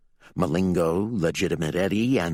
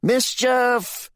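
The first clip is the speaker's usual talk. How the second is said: high, loud call by name; yelled; 5 dB louder than usual. A narrator's reading. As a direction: yell for urgent help. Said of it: "she yelled".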